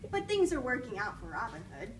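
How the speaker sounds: ordinary delivery, nothing marked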